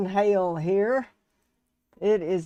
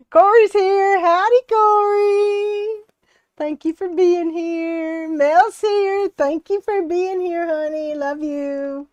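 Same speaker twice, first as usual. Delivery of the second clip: high, drawn-out singsong welcome, easing off; excited greeting; 11 semitones higher, 6 dB louder than usual. Real, not acted. Livestream host welcoming viewers as they join, excited high greeting. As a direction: call out a delighted, high-pitched hello, held long.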